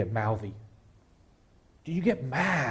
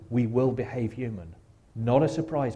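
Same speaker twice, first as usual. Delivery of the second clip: usual speaking voice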